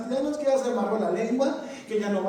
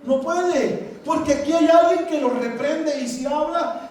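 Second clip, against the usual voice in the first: usual speaking voice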